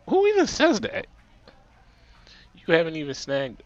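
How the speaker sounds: very high, loud squeak of disbelief; squealed mock-indignant question; incredulous retort. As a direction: shoot way up in pitch, offended. Indignant retort to spinster remark.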